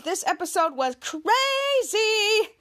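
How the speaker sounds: "crazy" stretched out long and high; amazed emphasis. Real, not acted. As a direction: draw out "crazy" with exclaiming wonder. Host marvels at the episode.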